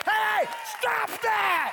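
high, loud, long-stretched rebuke; commanding shout. Shown as a demonstration. Preacher showing what man of God says, shouted command. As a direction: bellow it slowly as a man of God. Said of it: "a man of God to tell you".

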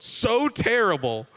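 drawn out in mock horror; quoting the laugh line. Pastor quoting the clip to the congregation, mock dismay. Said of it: "and I quote".

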